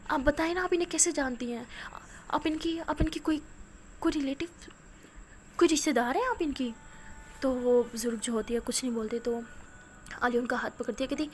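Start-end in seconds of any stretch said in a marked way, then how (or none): 0.1-6.7 urgent pleading. Acted. Girl pleading, urgent questions.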